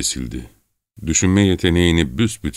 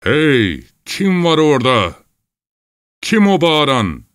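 high, drawn-out call across water; distant watchman's high shout; ringing shout; 10 semitones higher, 4 dB louder than usual. A narrator's reading. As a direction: holler a challenge from far away. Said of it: "Ses yeniden çınladı".

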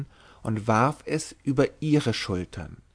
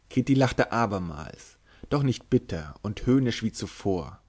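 usual speaking voice

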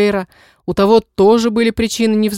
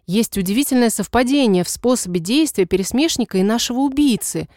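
ordinary delivery, nothing marked

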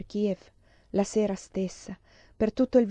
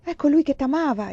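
high-pitched exclamation; raised cry of discovery; exclaiming; 7 semitones higher, 4 dB louder than usual. A narrator's reading. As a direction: exclaim it theatrically, voice lifted. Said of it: "esclamò".

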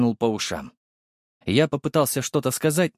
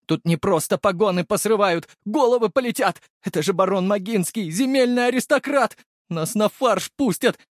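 high, frantic warning; panicked alarm; 10 semitones higher than usual. A narrator's reading. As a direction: panic about the punishment to come. Guard panics over dying aristocrat. (паника, срывающаяся на высокие тона)

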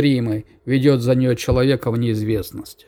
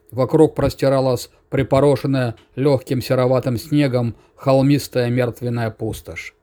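plain reading voice

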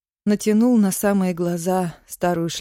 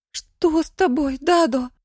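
put-on high, anxious child's question; worried little girl asking; scared girl; 8 semitones higher than usual. Scared girl asks father.

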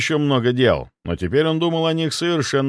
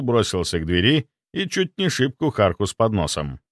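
ordinary delivery

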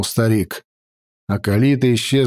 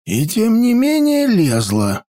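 put-on old mage voice; 10 semitones higher than usual. A narrator's reading. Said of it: "заметил старый маг".

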